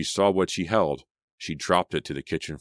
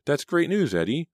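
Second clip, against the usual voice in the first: high, louder burst of delight; excited cry; 11 semitones higher, 4 dB louder than usual. A narrator's reading. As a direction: exclaim the good news, pitch soaring. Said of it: "Selina cried".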